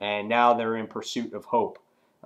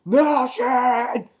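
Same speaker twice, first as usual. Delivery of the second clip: high, drawn-out acted yell; retold scream; 12 semitones higher, 5 dB louder than usual. Comic character screams desperately as the door opens, imitated scream. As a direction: cry the name out long, high and loud. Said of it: "and he screams".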